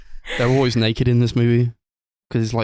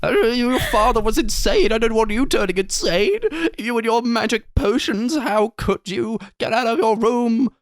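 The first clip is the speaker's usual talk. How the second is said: high, hammed-up shrieking mother; hysterical mum; 11 semitones higher than usual. Mum bursting into her son's room, hysterical high-pitched mock scolding. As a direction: wail it in a very high, over-the-top panic. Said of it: "It's like going into a son's room, she's like".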